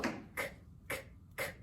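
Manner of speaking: tiny voiceless "k" clicks; isolated letter sound. Shown as a demonstration. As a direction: click out "k" with no vowel. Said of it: "los sonidos".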